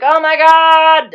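loud, high, drawn-out wail; hammed-up tearful outburst, stretched out; mock wail. Retelling Charlotte as she starts crying, mock wail. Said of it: "she starts crying".

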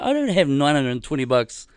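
loud, climbing to high and quick; re-enacted flabbergasted protest; retold incredulous alarm. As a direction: throw the question back in comic panic. Acts out alarm at the cost.

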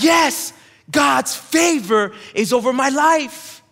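shouted, slow and high, hands-up victory; mock triumphant cry. Preacher hamming up a worshipper's triumphant shout. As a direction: crow it like you just won the parking lot. Preacher mocks shallow prayer joy.

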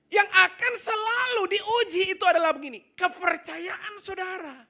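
high, loud, near-shouted sermon climax; full-force preaching. Acted. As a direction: drive it home like a preacher at full cry. (khotbah berapi-api dengan suara keras dan tinggi)

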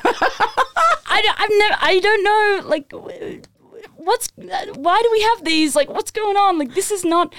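very high, stammering mock distress; flustered panic. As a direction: act out falling apart in confused protest. Acting out tearful panic at range.

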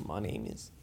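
low, abandoned New Zealand attempt; half-hearted New Zealand accent. Performer attempting a comedy duo, low New Zealand accent. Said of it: "an impression of Flight of the Conchords".

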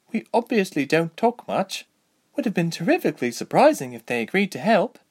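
high, lively elf soldier; Deirdre. Acted. Voices Deirdre.

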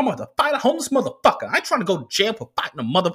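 high, worked-up, rapid-fire cursing; ranting driver impression. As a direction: rant like a hot-headed driver venting to a passenger. Imitated driver's long angry rant.

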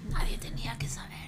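hushed secret whisper. Acted. Confessing the donut she brought.

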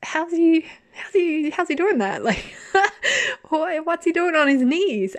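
high, giggling disbelief; laughing in disbelief at a kneeling corpse; incredulous laughing question. Retold or imitated. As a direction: ask it while cracking up at the absurdity. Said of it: "And I was like".

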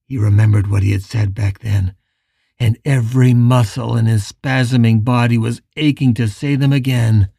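usual speaking voice

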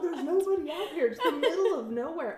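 high, loud mock-incredulous shout; hammed-up outburst breaking into laughter; loud disbelief. Friends answer man seeing his brother in the desert, mock incredulous.

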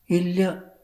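small, high-pitched answer; Sulekha. Acted. Crying actress answering her tutor, high and subdued. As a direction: lift it high and keep it small.

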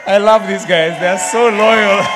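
loud, slow, laughing affection; laughing fondly. Real, not acted. Preacher praising loyal fans, loud and laughing. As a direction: praise them through the laugh.

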